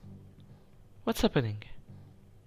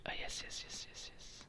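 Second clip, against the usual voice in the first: whispering; 21 dB quieter than usual. Real, not acted. Sneaking past guards in game.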